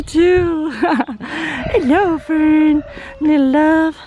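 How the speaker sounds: high, delighted pet-greeting voice. Real, not acted. Owner greeting goats wanting love, high gushing baby talk.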